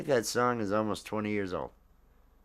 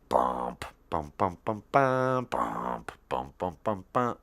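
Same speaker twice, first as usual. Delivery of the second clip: vocal bass riff; 3 semitones lower, slower than usual. Shown as a demonstration. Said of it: "That was the music to it".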